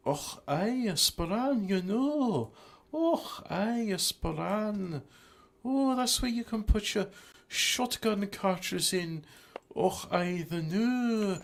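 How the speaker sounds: high, playful Scottish caricature; mock Scottish accent. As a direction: ham up a high-pitched Scottish voice. Mock Scottish riff on item name.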